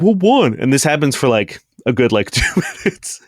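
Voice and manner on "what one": confused Brackum calling back. Acted. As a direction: shout back, baffled, across the room. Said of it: "And he's like".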